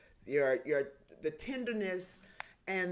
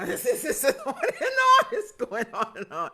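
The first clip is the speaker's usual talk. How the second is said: laughing; 7 semitones higher, 10 dB louder than usual. Real, not acted. [name] laughs at day count.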